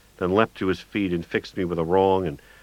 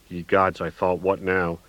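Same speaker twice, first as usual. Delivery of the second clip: usual speaking voice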